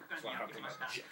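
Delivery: ordinary delivery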